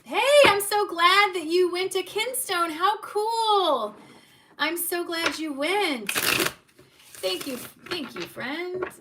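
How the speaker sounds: high, loud, gushing thank-you; squealing with joy at a kind viewer; excited thanks. Real, not acted. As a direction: gush with delight over the gift. Thanking a viewer's donation live.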